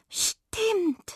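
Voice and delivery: high, airy sighed agreement; sighing. A narrator's reading. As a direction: agree on a sigh. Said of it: "seufzte die Waldmaus". (hoch geseufzt)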